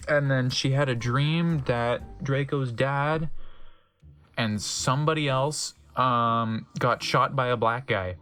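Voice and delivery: ordinary talk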